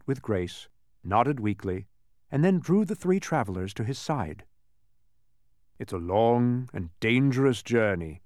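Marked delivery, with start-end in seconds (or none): none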